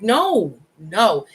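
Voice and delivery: normal talking voice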